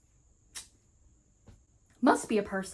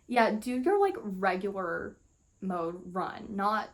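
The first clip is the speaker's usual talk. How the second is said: ordinary delivery, nothing marked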